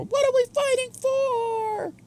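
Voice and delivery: high, slow, stretched-out question; dramatic high voice. Shown as a demonstration. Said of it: "repeats line in dramatic voice".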